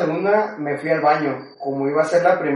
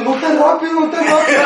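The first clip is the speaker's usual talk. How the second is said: anxious yell; 13 semitones higher, 7 dB louder than usual. Retold or imitated. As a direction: beg her to ease off over the bumps. Bumps hurt, begs mom slow down. (grito de niño pidiendo que frene por los topes)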